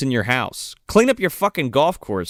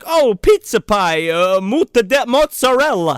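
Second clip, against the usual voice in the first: mock Italian accent; 8 semitones higher, 5 dB louder than usual. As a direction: ham up a terrible Italian accent. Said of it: "talking like this".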